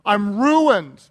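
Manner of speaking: loud retold cry of ruin; emphatic, stopping short of a scream. Preacher quoting Isaiah's despair, loud emphatic cry. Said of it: "he cried".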